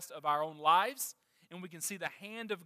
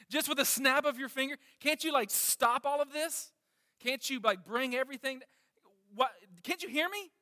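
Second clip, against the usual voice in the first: retold anguished plea, pitched well higher; desperate cry to God; 6 semitones higher, 3 dB louder than usual. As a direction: act out David's desperate cry, begging God. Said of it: "he cries out".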